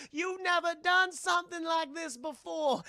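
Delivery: high put-on medium voice; objecting medium.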